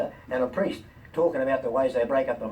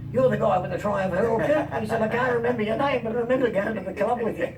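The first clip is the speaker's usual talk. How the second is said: higher, lightly voiced Welsh star; 9 semitones higher than usual. [name] recalls him, voiced.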